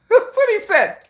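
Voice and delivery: high, loud talk through laughter; laughing squeal. Real, not acted. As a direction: crack up as you say it. Laughs at own retold quote.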